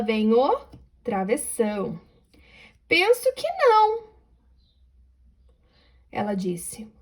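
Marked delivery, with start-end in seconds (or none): 2.9-4.1 much higher exclamation on "não"; exclaiming. Shown as a demonstration. 6.1-6.9 whispered aside. Real, not acted.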